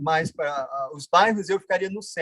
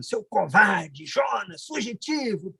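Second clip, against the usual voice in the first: drawn-out mock scolding of himself; acted self-cursing; 2 semitones higher, much slower than usual. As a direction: curse yourself slowly, word by word, without shouting. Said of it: "amaldiçoando". (xingando a si mesmo, arrastado)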